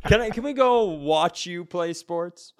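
high laughing whine; laughing plea. Real, not acted. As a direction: giggle while pleading to go. Laughing begging to leave.

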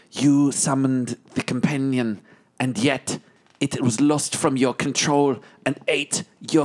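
slow, deliberate threat at normal volume; measured intimidation, drawing out key words; menacing interrogation. Acted. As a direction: lean on them slowly, without raising the voice. Leaning in to intimidate the prisoner.

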